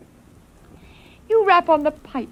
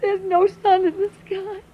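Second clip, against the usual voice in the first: crying through the words; mother weeping that the sun is gone; tearful; 8 semitones higher than usual. Acted. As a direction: let the tears break in as she realizes.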